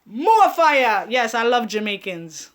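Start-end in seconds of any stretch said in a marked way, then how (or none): 0.1-1.1 loud chef catchphrase yell; Ramsay shout. Retold or imitated. Vlogger bellowing a TV chef's catchphrase, loud.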